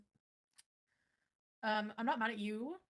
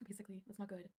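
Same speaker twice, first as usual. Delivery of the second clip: whispering; 9 dB quieter than usual. Real, not acted. Whispered aside after laugh.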